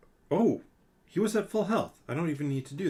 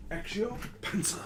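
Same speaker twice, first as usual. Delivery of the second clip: mock spell; 8 semitones higher than usual. Jokingly casting a spell for pencil.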